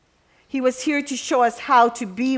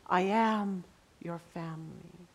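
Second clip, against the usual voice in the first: soft, hushed answer of comfort; tender reply; 3 semitones lower, 9 dB quieter than usual. Retold or imitated. Preacher voicing God's answer to a weeping inmate, soft and tender. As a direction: gently reassure her.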